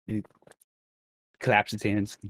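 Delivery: normal talking voice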